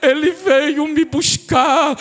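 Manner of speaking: slow, tearful, broken voice; crying grandmother. Retold or imitated. Dying grandmother weeping as she sees her hour. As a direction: let the tears through, slowly. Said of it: "começa a chorar e dizer".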